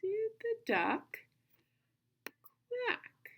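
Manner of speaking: high, slow "Did the duck", long pause, then "quack"; marking the phrases. Shown as a demonstration. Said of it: "mark up our first phrase".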